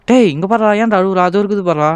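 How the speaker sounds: loud, insistent hailing; calling out. Real, not acted. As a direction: call sharply for someone's attention. Calls out to a crying character.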